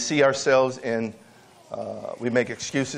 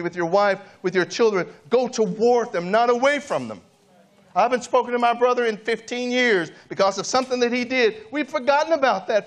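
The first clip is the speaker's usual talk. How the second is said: raised, higher preaching voice building to a cry; fervent preaching; 10 semitones higher than usual. Acted. Pastor urges families to forgive, fervent preaching. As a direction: pastor pleads with the church to reconcile, fervent preaching.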